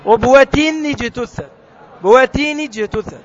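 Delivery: ربوة اجتثت joined with a kasra, rabwatin ijtuththat; slow model linking. Shown as a demonstration. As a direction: join ربوة اجتثت on an i sound. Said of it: "مكسورة".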